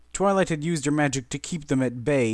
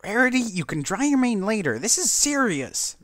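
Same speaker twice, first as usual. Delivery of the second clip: high, louder, slowed insistence; insistent Rainbow Dash; 10 semitones higher, 3 dB louder than usual. A narrator's reading. Rainbow Dash scolding Rarity, insistent and high. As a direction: press the point firmly. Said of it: "Rainbow Dash insisted".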